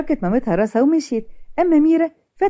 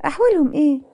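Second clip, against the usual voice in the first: higher-pitched sarcastic question; a touch of sarcasm, pitched up; 4 semitones higher than usual. A narrator's reading. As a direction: ask it with mild mockery. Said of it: "وميرا بسخرية".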